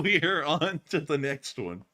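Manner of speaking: ordinary talk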